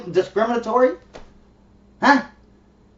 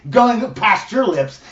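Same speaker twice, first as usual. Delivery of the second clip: usual speaking voice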